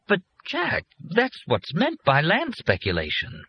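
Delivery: high, slow puzzled tone; baffled, rising disbelief. A narrator's reading. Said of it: "Leo was puzzled".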